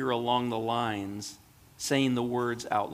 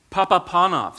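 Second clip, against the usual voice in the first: higher, louder call of his name; a voice calling him, a bit higher and louder; 5 semitones higher, 9 dB louder than usual. A narrator's reading. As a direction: call him once more. The voice calls again.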